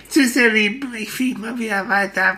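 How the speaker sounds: hohe, flinke Vogelstimme; fröhlicher Spatz, viel höher, munter; Spatz Frido. Acted. Frido verabschiedet sich. (Spatz Frido stellt sich den Kindern fröhlich vor)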